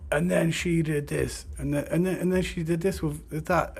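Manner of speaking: whiny venting about the ex; high-pitched ranting imitation of himself. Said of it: "I'm in the ranting stage".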